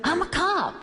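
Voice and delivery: high, punched-out reply; acted-out eager declaration; retold proud announcement.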